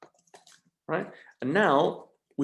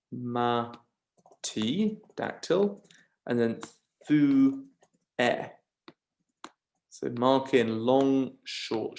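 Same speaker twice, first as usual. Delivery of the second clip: ordinary talk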